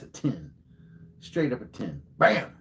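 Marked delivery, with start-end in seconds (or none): 2.2-2.5 triumphant bam. Real, not acted. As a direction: slam it down like a winning move. Celebrating his own story.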